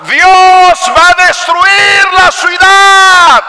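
high, loud, slow doom proclamation; Jonah's warning. Acted. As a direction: shout the prophecy of destruction. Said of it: "el mensaje de Jonás era".

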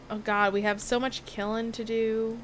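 ordinary delivery

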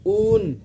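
ऊन with a long stretched oo; ऊन pulled long before the n; drawn out. Shown as a demonstration. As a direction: hold the oo in ऊन. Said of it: "थोड़ा खींच के बोल रहे हैं".

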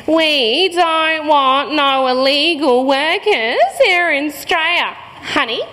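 slow, drawn-out condescension; slowly. Retold or imitated. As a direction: spell it out slowly as to a child. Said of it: "this time slowly".

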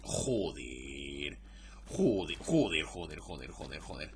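low muttered swearing, fading off; cursing under breath. Real, not acted. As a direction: mutter joder over and over to yourself. Disaster in game, muttered curses.

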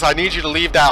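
ordinary talk, nothing marked

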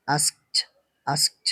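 আস্কড said "askt", closing on t; final -ed as T. Shown as a demonstration. Teacher repeats the model for learners, t ending on আস্কড. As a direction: end আস্কড with t, never a voiced d. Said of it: "এভাবে হবে".

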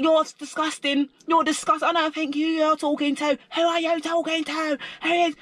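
high, mock hysterical rant; mock screaming. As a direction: mimic her escalating screech, pitched right up. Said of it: "She started screaming".

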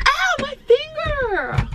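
high drawn-out cry of pain. Real, not acted. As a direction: yelp as the finger gets pinched. Hurts finger moving car seat.

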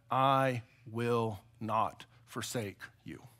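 very slow, soft, word by word; solemn promise. Acted. The Lord reassuring his weary people, slow solemn promise. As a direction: weigh each word slowly and quietly. Preacher voices the Lord's promise.

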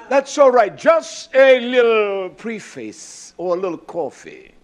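rising into loud, full-force preaching. Real, not acted. Preacher warming up the congregation, loud preaching. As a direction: launch into the sermon at full voice. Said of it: "Can I preach now?".

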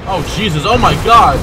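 loud, high panicked yelp; startled scream. Real, not acted. Player caught by a sudden enemy, screaming in fright. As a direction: shriek as the monster jumps out. Jump scare in horror game.

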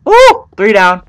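high, loud delighted whoop; excited whoop. Real, not acted. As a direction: yelp with the thrill of a hit. Another ghast kill.

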